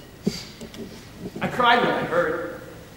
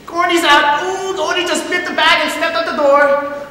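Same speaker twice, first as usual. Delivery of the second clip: high, loud, gleeful taunt; jeering high-pitched taunt at a card loser; gloating; 11 semitones higher, 8 dB louder than usual. Acted. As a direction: crow it at the loser like a mocking kid. Teddy gloats over Gordy losing.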